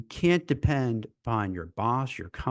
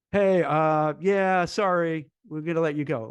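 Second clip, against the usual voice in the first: louder, offhand "yeah, sorry" stretched out; mock HR caller; 3 semitones higher, 5 dB louder than usual. Recruiter imitating a Zoom layoff call, louder and offhand. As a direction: play the careless stranger firing someone. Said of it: "get on a video call and say".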